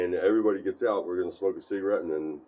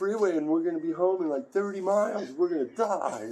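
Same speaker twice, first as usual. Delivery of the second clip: panicked whining; 11 semitones higher than usual. Retold or imitated. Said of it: "So he's just".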